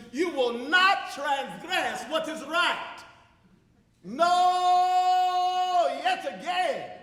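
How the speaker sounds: slow, then a long ringing cry; fervent cry. Acted. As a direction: build up and hold the final no.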